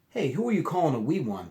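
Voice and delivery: high, loud mock offence; indignant huff. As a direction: protest like an insulted little pet. Fluffy offended by wee.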